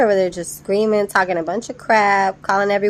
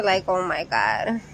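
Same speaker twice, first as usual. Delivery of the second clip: usual speaking voice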